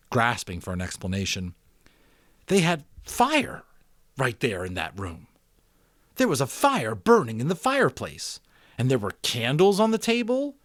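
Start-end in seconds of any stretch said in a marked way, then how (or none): 2.5-10.6 Jonas explaining. A narrator's reading.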